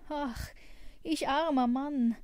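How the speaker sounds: woeful carter's outcry; loud lament. A narrator's reading. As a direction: wail in self-pity. Carter laments his third loss.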